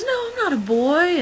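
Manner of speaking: high-pitched imitation of a seven-year-old girl; little girl voice. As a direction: squeak it out like a small girl being corrected. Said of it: "and she goes".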